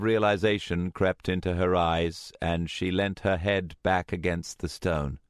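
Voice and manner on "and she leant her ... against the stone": ordinary delivery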